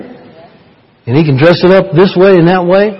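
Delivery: usual speaking voice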